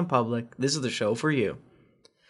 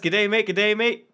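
mock Australian G'day, higher; Australian accent; 6 semitones higher than usual. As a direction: ham up an Aussie greeting. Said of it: "Chantoria from Canada says".

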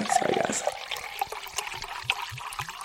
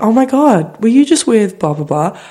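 gushing relative; 6 semitones higher than usual. Retold or imitated. Family member gushing, role-played.

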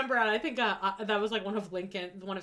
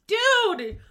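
loud, high, fed-up yell; exasperated shout; 12 semitones higher, 9 dB louder than usual. Real, not acted. Podcast host yelling at Mike's cluelessness, exasperated. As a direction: blurt it out like you can't believe him.

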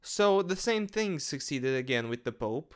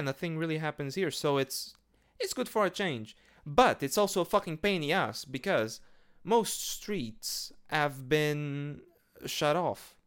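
normal talking voice

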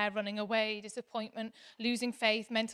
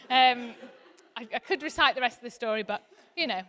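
ordinary talk

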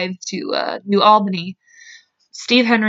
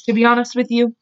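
normal talking voice

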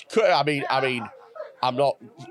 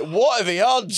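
lightly voiced kid, pitched up; kid's reaction; 7 semitones higher, 4 dB louder than usual. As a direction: imitate a kid reacting, higher than usual. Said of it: "And the kid's like".

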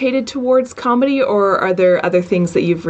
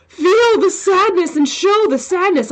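much higher, pushy mock-director voice; insistent mock directive; 10 semitones higher than usual. Actor mimicking what acting demands of her, insistent mock directive. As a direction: imitate a high, over-eager demand for emotion.

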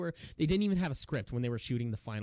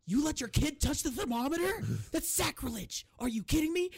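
high, loud mock outrage; outraged Will Ferrell; 4 dB louder than usual. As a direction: ham up the horror of a rule broken. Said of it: "even Will Ferrell's like".

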